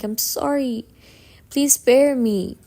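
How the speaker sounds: pleading, rising to a loud cry on please; begging for mercy. Retold or imitated. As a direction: beg the monster to spare her. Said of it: "She's begging, like".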